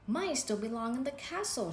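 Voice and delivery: ordinary delivery, nothing marked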